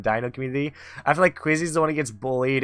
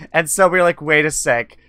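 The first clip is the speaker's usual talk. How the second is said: ordinary delivery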